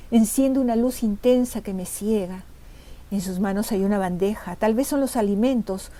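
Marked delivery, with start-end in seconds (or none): none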